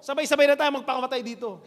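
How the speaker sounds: fed up and venting to his friends; exasperated rant. Acted. Worker complaining about lunch.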